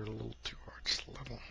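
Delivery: sheepish whisper. Real, not acted. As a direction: whisper it, embarrassed. Sheepish after hitting mic.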